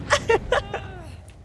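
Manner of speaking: high giggle, tailing down; high laugh. Real, not acted. Laughing at a chat message.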